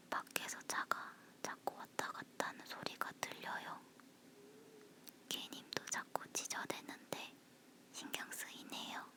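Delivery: breathy whisper close to the mic. Real, not acted. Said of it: "속닥거리는 목소리라서".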